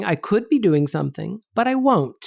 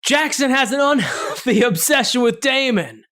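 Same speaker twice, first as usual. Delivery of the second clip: Nicolas Cage; 5 semitones higher than usual. Pretend. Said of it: "I'll be Nicolas Cage".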